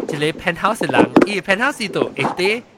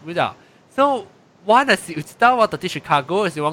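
ordinary talk

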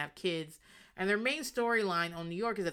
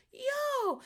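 surprised yo. Retold or imitated. Shocked reaction to body slam.

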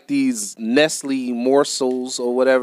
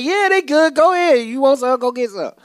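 much higher, louder mom voice; imitating his mother; 14 semitones higher, 4 dB louder than usual. Son retells mom urging him to eat, in a high mom voice. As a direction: play mom offering the morsels. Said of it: "Why you sound just like her?".

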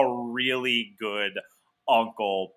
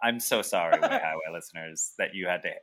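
low, rambling stoned apology; 7 semitones lower, 7 dB quieter, faster than usual. Real, not acted. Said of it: "My edible's kicking in".

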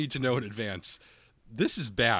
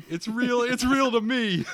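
crying fan; 12 semitones higher, 5 dB louder, slower than usual. Pretend. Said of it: "somebody's crying now. They're like".

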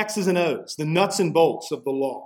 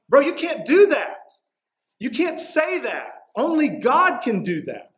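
shocked scribes; 9 semitones higher than usual. Retold or imitated. Scribes objecting to Jesus, high incredulous protest. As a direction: play the appalled scribes calling him out. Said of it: "And they're like".

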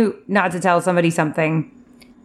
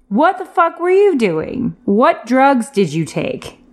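slow, high-pitched disbelieving demand; incredulous scolding; 6 semitones higher, slower than usual. Pretend. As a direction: scold the character, stretching the words. Listener scolds character in disbelief.

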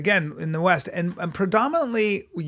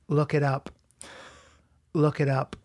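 low, soft librarian voice; 4 semitones lower, 6 dB quieter than usual. Acted. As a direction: quietly send them off like a librarian. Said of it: "my impression of a librarian".

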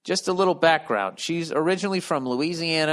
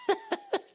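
high wheezing laugh; 6 dB quieter than usual. Real, not acted. Big laugh after joke.

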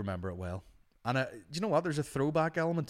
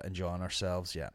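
normal talking voice

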